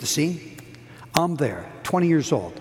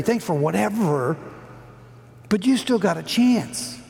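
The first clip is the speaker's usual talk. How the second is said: impassioned preaching; 6 semitones higher than usual. Acted. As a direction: preach it like a last chance for the congregation. Urges men to call fathers.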